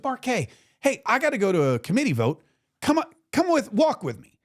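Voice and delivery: a hint of a buzzing, hurried congressman; excited congressman. Congressman voiced in retold story.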